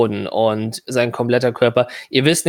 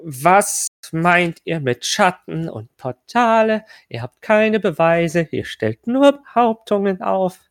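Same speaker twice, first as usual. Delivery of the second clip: higher, slow, stern official; impatient stern official; 5 semitones higher, slower than usual. Acted. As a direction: lose patience, slowly and high. GM voices impatient NPC.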